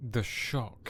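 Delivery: gasp, then hushed mock astonishment; mock shock. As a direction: feign being scandalised with a gasp.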